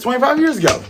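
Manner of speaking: ordinary talk, nothing marked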